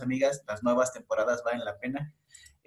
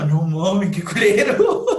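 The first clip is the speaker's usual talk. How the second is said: mock-shocked outburst, louder and higher, laughing through it; shocked reaction; 5 semitones higher, 7 dB louder, slower than usual. Telling a friend about a gross episode, laughing shocked outburst. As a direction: blurt it out like you can't believe what you saw. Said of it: "Es así como".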